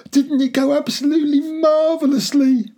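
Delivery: high, loud, exultant cry; breathless triumph. A narrator's reading. Father to son after the escape, exultant and breathless. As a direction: gush it at full excitement. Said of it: "glowing with triumph".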